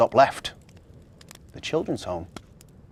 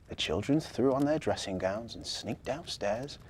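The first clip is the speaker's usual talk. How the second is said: ordinary delivery, nothing marked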